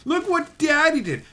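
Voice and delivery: indignant imitation of an entitled dad; mock pissed off. Slighted dad demanding special treatment, mock indignation. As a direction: mimic his outrage, jumping high on the boasts. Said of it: "the guy got so pissed".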